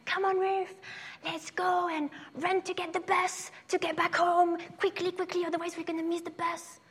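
high, urgent voice of the school friend hurrying her. Retold or imitated. Friend urging Ruth to run for the bus, high and hurried. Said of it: "my friend is like".

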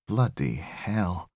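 slow, soft groan of a curse; slow disgusted groan under the breath. Acted. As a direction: mutter it with disgust, drawn out. Tech groans at burning body.

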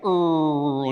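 imitated rolling creature groan; long mock growl in the throat; Chewbacca growl. Preacher imitates Chewbacca fixing the ship, growling. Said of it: "'cause he's been like".

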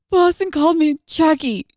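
high, whiny tearful complaint; mock crying child. Mom mimics her crying son tattling, mock sobbing. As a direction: imitate a kid tattling in tears. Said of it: "cries multiple times a day".

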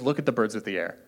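imagine Jesus pointing up at the birds, gently; Jesus pointing outdoors. Shown as a demonstration. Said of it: "I really love to imagine that this is outside and that Jesus literally goes".

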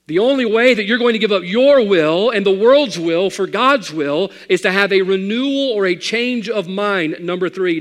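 loud, high preaching cry; full-force preaching. Acted.

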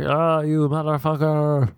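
mock rough lover. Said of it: "And you're like".

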